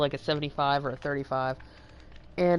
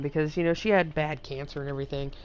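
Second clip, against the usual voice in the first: ordinary talk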